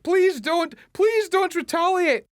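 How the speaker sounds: high, loud frantic pleading; panicked pleading. Retold or imitated. The frightened mum begging nobody to hit back, high loud pleading. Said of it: "Starmer's the mum".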